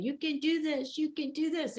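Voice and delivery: a light, high, hushed little cheer; mock cheering on. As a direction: imitate supporters urging someone on, kept small. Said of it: "your ancestors are around cheering you on".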